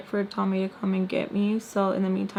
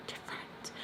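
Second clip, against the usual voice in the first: whispering; 18 dB quieter than usual. Real, not acted. Pleading with the doctor.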